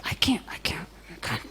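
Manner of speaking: breathy whisper, voice dropping away; hushed, defeated I can't; whispered despair. Retold or imitated. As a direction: whisper it like you're overwhelmed. Retelling her own panic.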